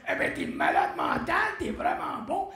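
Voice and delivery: loud, put-on star voice; imitated singer's praise. Singer praising the dancer after his act, booming. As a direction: boom out the praise as someone else.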